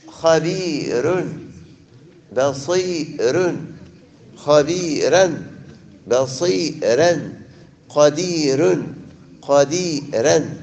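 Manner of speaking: خبير بصير قدير drawn out slowly with thin raa; slow drill words; slow examples. Shown as a demonstration. Teacher drills students on the thin raa slowly. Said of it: "examples of thin raa".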